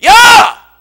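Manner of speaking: explosive, high yell; acted full-voiced challenge; David's indignant shout. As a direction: bellow it in outrage. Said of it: "다윗이 거기 가는데".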